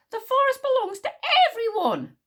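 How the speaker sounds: high, loud indignant yell. A narrator's reading. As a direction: shout it out as a protest. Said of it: "yelled Wish".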